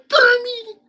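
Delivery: very high, loud, squealing puppet pig; Miss Piggy. Shown as a demonstration. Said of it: "my impersonation of Miss Piggy".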